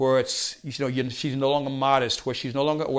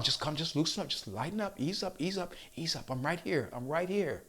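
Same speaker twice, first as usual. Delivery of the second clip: soothing coach; 7 dB quieter than usual. Retold or imitated. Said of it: "And she's holding on".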